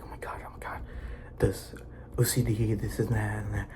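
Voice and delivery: hushed, frantic whisper; panicked whisper. Retold or imitated. Someone with OCD panicking over intrusive thoughts, whispering.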